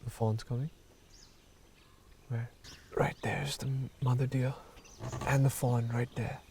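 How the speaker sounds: hushed soft voice; wildlife watching whisper. Real, not acted. Hushed watching at water hole.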